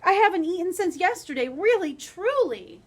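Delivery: high, loud begging, slowing on really truly; pleading boy. Acted. Jack begging the giant's wife for breakfast, high pleading. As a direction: plead like a hungry boy, stretching the end.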